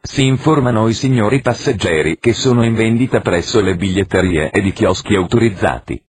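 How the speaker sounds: ordinary delivery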